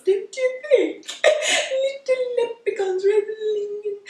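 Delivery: hammed-up hilarity in a tittering hostess voice; amused at your own silly idea; tickled giggling. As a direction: giggle through the words. Amused at own leprechaun fancy.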